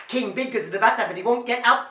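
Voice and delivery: rapid-fire refrain; very fast. Shown as a demonstration. Storyteller challenging children to keep up, very fast. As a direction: race through the words. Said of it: "Can you go faster?".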